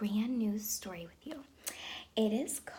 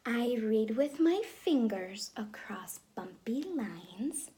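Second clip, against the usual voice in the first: put-on lively storybook read-aloud for kids; reading to children; 3 semitones higher, 6 dB louder than usual. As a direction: read it like story time in class. Teacher reads picture book aloud.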